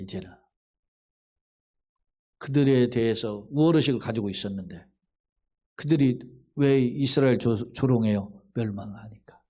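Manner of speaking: ordinary talk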